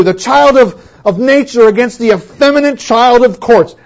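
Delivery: loud, high oratorical reading; loud, sweeping declamation; grand reading. Retold or imitated. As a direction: read it like a Victorian orator. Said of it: "not gonna read it in a British accent".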